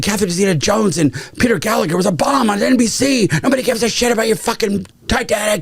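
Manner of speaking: high-pitched, mounting studio rant; imitated exasperated tirade. Studio executives berate director over Titanic spending, imitated exasperated tirade. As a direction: mimic executives fed up with a director.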